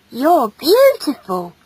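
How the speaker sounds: put-on drawn-out boyish admiration; admiring boy. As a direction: tell her with slow, earnest wonder. Shy boy compliments her.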